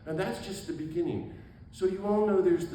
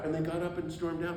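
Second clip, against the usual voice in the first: normal talking voice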